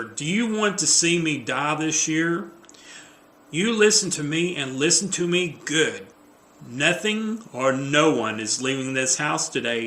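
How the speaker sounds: lecturing a partner with urgency; emphatic, mildly dramatic scolding; insistent Jennifer. Acted. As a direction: lay down the rules, exasperated. Script reading as Jennifer.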